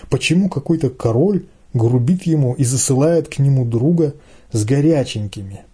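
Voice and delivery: usual speaking voice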